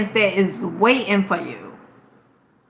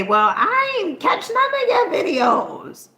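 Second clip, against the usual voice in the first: mock complaining viewer; 9 semitones higher than usual. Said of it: "some people say".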